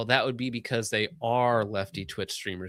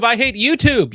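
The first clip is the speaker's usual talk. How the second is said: even louder, higher shout; louder mock rant; 10 semitones higher, 10 dB louder than usual. Repeating the donor's complaint as a bigger shout. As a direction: push the rant harder. Chat line read twice.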